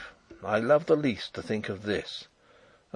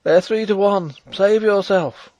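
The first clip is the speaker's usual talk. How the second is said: urgent shout; 9 semitones higher, 9 dB louder than usual. Acted. Wounded man urges Rupert away.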